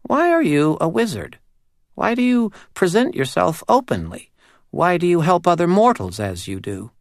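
high, tiny, eerie doll voice; a small uncanny doll spirit speaking. Acted. Doll questions the wizard.

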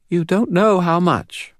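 put-on high, serious child's voice with a faint British lilt; serious little girl, faint British accent. A narrator's reading. Narrator voicing the girl to Dresden, serious and high. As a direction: speak like a grave little girl.

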